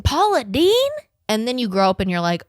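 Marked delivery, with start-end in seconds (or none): 0.0-0.9 shocked disbelief. Retold or imitated. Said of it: "I was like".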